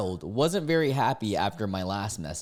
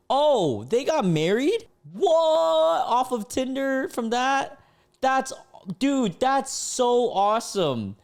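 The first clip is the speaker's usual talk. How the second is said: high, loud, thrilled disbelief; amazed excitement; 13 semitones higher, 5 dB louder, slower than usual. Real, not acted. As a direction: react in high-pitched happy astonishment. Amazed that the match got married.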